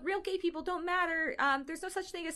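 high-pitched mocking fan voice. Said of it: "they're like".

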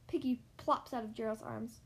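plain reading voice

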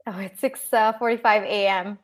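normal talking voice

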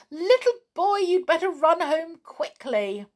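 high, loud pixie voice; anxious high-pitched command; urgent pixie warning. A narrator's reading. Pixies urge boy to flee.

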